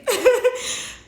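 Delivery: short high giggle; real bright laugh; light laugh. Real, not acted. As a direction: laugh at her own quip. Laughs at own joke.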